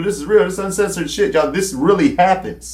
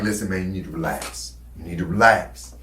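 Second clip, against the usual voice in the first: much lower and much softer, steady and reassuring; calming cellmate; 8 semitones lower, 7 dB quieter than usual. Retold or imitated. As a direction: settle a jumpy young inmate down, hushed. Older cellmate calming him down.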